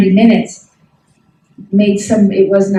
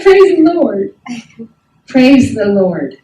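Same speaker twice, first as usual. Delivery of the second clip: retold joyful praise; slower than usual. Retelling her joy at his relief.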